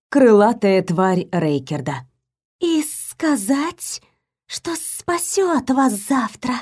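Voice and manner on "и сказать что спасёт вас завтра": slow, high, soft serpent hiss; slow hissing serpent, gently; snake voice. A narrator's reading. Talking snake answers telepathically. (медленный шипящий голос змеи)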